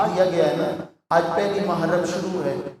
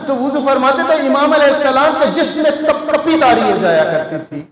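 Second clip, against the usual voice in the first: loud, higher preaching voice; full-force preaching; 4 semitones higher, 5 dB louder than usual. Acted. Preacher urges humility on mourners, louder and higher. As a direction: drive the lesson home loudly.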